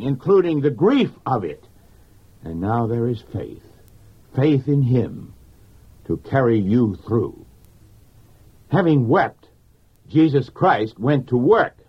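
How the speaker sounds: normal talking voice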